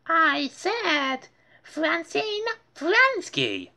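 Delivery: very high, slow, insistent; impatient princess. Acted. Muffy impatiently calling for Francine at her party. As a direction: repeat the name with pointed impatience.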